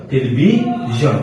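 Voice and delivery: টেলিভিশন slowly, split as "telibi - zhon"; broken-word demo. Shown as a demonstration. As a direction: pause before the last syllable of টেলিভিশন. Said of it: "উদাহরণটা দেখেন". (টেলিভিশন থেমে, ধীরে)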